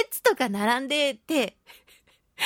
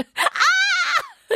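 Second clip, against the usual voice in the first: startled shout; 6 dB louder than usual. Retold or imitated. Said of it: "spotting child far away".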